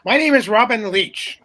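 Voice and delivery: put-on self-deprecating go at Robin Leach's British accent. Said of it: "I can't do the, the accent".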